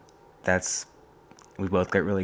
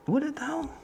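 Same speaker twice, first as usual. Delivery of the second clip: skeptical. Acted. Questions own NPR wish.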